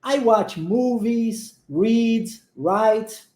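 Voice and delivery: very slow, every word spelled out; slow teacher demo; slow model reading. Shown as a demonstration. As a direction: say it slowly for the class to copy. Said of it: "vamos à frase".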